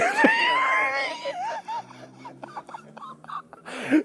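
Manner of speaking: high breathless laughing fit; helpless laughing fit. Real, not acted. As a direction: crack up at your own story. Laughing at own story.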